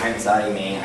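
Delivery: ordinary delivery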